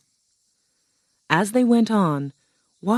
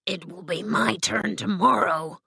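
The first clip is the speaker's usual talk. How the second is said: put-on lion character voice; Cowardly Lion; 2 semitones higher than usual. Lion tells his friends it is his turn, put-on creature voice. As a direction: play the lion getting himself ready.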